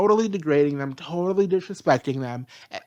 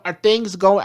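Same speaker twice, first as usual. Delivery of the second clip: ordinary talk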